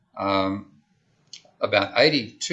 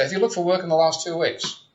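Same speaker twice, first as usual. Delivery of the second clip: higher, louder, mock official asking at the door; surveyor's question; 8 semitones higher, 3 dB louder than usual. Survey caller asks a household about jobs, lightly mimicked, higher and louder. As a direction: play the survey caller and put it brightly to the stranger.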